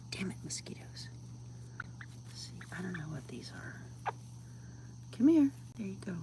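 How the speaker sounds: whispered aside; whispering. Real, not acted. Coaxing a bug out at night, whispered. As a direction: keep it to a hush.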